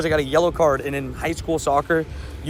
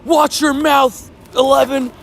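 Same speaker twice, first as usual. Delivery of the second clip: high, slow ref scolding him; stern ref; 9 semitones higher, 3 dB louder, slower than usual. Retold or imitated. Referee scolding the player for cursing, slow and high. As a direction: drag out the warning like an irritated referee. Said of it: "And the ref goes".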